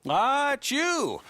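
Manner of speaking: high, drawn-out greeting; hearty greeting. Acted. Banker greets returning customer.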